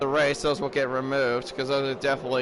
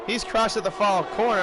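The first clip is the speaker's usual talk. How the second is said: excited play-by-play; 8 semitones higher than usual. Acted. Live race commentary, crash.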